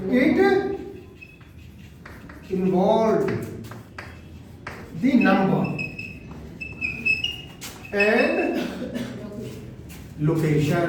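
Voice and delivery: long gaps after each small chunk; slow dictation. Shown as a demonstration. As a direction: dictate slowly, waiting while students write. Dictating phrase for note-taking. (धीरे-धीरे, रुक-रुक कर लिखवाते हुए)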